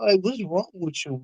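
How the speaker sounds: normal talking voice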